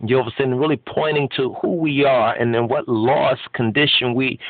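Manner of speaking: normal talking voice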